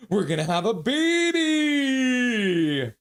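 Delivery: gleeful, rising holler; excited announcement. Real, not acted. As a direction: announce it like a goal, stretching the last word. Excitedly announcing baby news.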